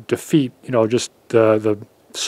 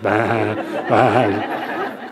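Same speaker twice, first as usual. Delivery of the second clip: sheep bleat; 2 semitones lower than usual. A narrator's reading. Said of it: "it was eating grass".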